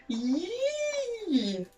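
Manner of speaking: a small high frightened squeak. A narrator's reading. Branch trembles, bird squeaks.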